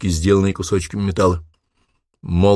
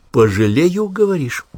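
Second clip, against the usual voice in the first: high mocking question; mocking sneer; 9 semitones higher, slower than usual. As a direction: sneer it back as a taunt. Officer mocks prisoner with pistol. (насмешливо)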